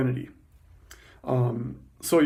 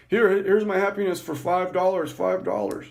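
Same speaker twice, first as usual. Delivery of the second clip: higher-pitched mock street seller; pitched-up, acted-out sales call; 5 semitones higher than usual. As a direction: hawk it like a cheap illicit bargain.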